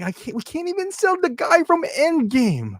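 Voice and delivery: very high comic wail; put-on helpless complaint, voice pitched far up; mock despairing whine. Toy makers failing to sell an Endgame figure, mock despair.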